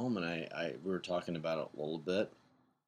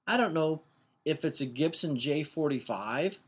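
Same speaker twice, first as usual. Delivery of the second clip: imitating his daughter; 7 semitones higher than usual. Dad voicing daughter's specific answer.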